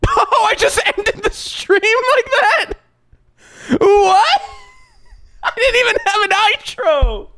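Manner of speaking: high, disbelieving cries; shocked. Real, not acted. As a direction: voice jumping up in shock. Shocked the stream ended.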